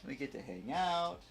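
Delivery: ordinary delivery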